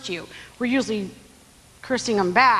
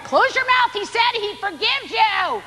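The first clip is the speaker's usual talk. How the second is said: exasperated hushing; 10 semitones higher, slower than usual. Retold or imitated. Someone hushes the begging servant.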